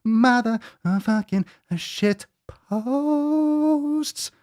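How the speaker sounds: very high, drawn-out mock fanfare; hammed-up segment intro; hyped announcement. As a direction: stretch each word out high and slow.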